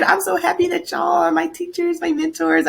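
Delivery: high put-on sweet voice; high, hammed-up delight; mock sweet gushing. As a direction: lay on the flattery playfully. Acts out sweet-talking her teachers.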